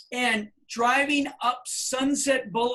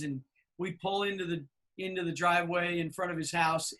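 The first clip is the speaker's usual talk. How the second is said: ordinary talk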